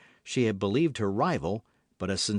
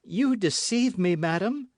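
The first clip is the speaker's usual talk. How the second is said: high, sharp accusation; accusing; 10 semitones higher, 3 dB louder than usual. A narrator's reading. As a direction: call her out as a liar. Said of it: "said the novice".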